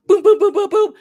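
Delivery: rapid string of high, loud booms; imitated banging noise. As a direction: punch out shutdown noises. Imitates groomer abruptly shutting service down.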